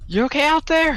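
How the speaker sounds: high, loud call out the window; yelling out. Acted. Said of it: "And then yell out".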